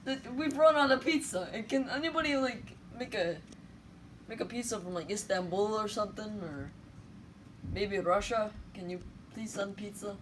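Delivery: high, loud, hammed-up Italian accent; a frantic Italian begging for pizza; jolly Italian pizza chef. Italy player in a pizza-chef persona.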